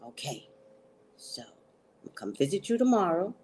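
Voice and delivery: low hushed secret-agent tone, then rushed; Secret Service talk. Retold or imitated. Dad on the phone sounding like Secret Service, hushed and hurried. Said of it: "talking like Secret Service".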